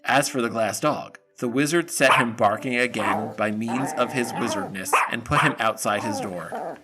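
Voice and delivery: usual speaking voice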